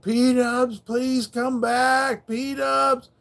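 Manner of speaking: high, slow tearful calling out; almost crying. Retold or imitated. Running through the woods, calling his lost dog near tears. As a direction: plead for the dog to come back, near tears. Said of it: "like, almost crying".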